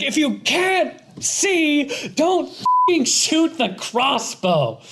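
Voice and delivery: high, slow mock-exasperated scolding; hammed-up indignant complaint, drawn out; exasperated outburst. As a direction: spell it out word by word, exasperated. Acted complaint of someone shot.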